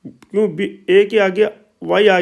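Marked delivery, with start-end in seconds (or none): none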